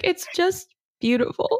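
a hint of welling up on the word beautiful; imitating a teary-eyed heroine; teary Sally quote. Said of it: "she's like".

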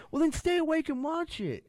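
high, exaggerated whiny comeback; mock indignant retort. Boyfriend retorting to his partner over a show, high and whiny. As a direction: whine it back at her, hammed up.

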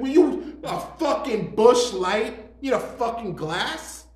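loud, high, slow mock rant; mock yelling. Bartender ranting at customer wanting a glass, loud mock yell. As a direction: drag out the outrage loudly.

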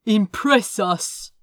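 lightly rumbled demand; rumbling lord. A narrator's reading. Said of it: "rumbled Lord Leaguer".

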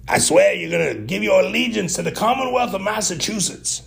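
high-pitched, hammed-up bad Massachusetts accent; horrible Massachusetts accent. Speaker mock-swearing allegiance to Massachusetts over a dunked Oreo, horrible high-pitched accent. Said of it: "I know that's a horrible accent".

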